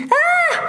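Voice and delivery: sharp high shriek of fright; high frightened cry; startled scream. Acted. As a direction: jump and shriek at the surprise. Startled by a sudden noise.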